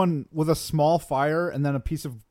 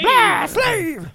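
mock drunken shout; 9 dB louder than usual. Echoing the drunk quote.

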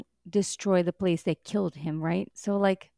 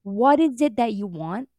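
mocking a rude greeting, louder; 3 semitones higher, 5 dB louder than usual. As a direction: imitate a curt, rude demand. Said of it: "so rude all the time".

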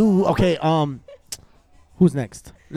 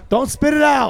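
shouting; 8 semitones higher than usual. Real, not acted. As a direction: shout at her not to spit. Urging her not to spit.

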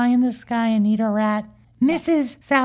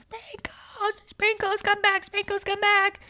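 high, soft pleading calls; mock distant shouting; 8 semitones higher, 6 dB quieter than usual. Playground crowd calling to Sprinkles, mock distant shouting. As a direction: call it high, as from far below. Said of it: "Everyone was down on the playground shouting".

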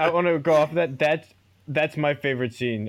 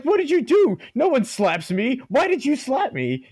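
high, loud indignant squeal; outraged actor; 11 semitones higher, 4 dB louder than usual. Retold or imitated. Slapped star actor demanding answers, high indignant squeal. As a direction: play the pampered star who just got slapped.

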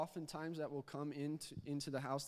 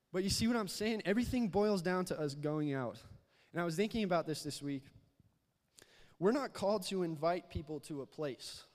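louder, pressing preaching voice; building sermon; 2 semitones higher, 5 dB louder than usual. Acted. Preacher urging the church to go out, louder preaching. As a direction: drive the point home to the congregation.

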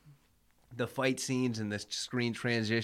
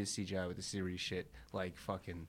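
hushed whisper; 4 semitones lower, 11 dB quieter than usual. Real, not acted. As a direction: whisper it like a secret. Whispering the dreaded title.

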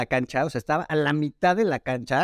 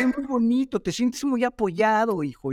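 higher, slow sweet-mom voice; doting mom; 7 semitones higher, slower than usual. Retold or imitated. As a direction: coo it like a proud mother to her son. Mom answers her embarrassed son.

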